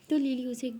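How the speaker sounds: plain reading voice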